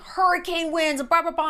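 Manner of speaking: high mocking voice of the ex. Mocking the ex's storm story, pitched high. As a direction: parrot his storm tale, mocking and high.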